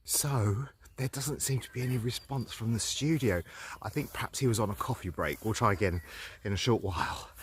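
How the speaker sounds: low, hushed aside; quiet. Acted. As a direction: murmur it to yourself. No response from the studio.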